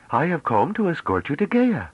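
high voice with rounded Gaian vowels; slight Gaian accent. A narrator's reading. Young envoy announces escort to Gaia, slight Gaian accent. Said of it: "Her command of Galactic Standard was slipping slightly".

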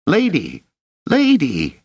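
put-on high, urgent boyish squeak; high-pitched cry for attention; squeaky shout. A narrator's reading. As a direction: squeak it out loud, calling urgently. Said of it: "shouting in a squeak".

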